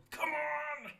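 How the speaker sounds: loud, very high re-enacted fan scream; going mad. Fan re-enacting sofa cheering, going mad. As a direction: belt it out like a fan losing it. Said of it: "I was going mad".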